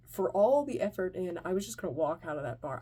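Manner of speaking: usual speaking voice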